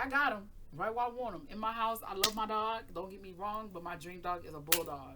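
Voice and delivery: ordinary talk, nothing marked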